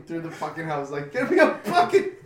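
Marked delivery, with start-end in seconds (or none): 1.1-2.1 high, loud mock panic; retold urgent yell. Sprinting through the house for help, mock frantic yell. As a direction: call out frantically, half laughing.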